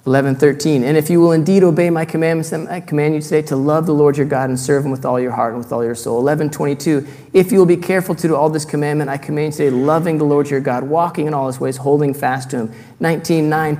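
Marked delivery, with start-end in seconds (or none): 0.8-6.2 louder, proclaiming reading voice; solemn public reading of scripture. Acted. Reads Deuteronomy aloud. 7.3-12.7 louder, proclaiming reading voice; solemn public reading of scripture. Acted. Reads Deuteronomy aloud.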